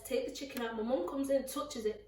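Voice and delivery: ordinary talk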